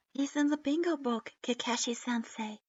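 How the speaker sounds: ordinary delivery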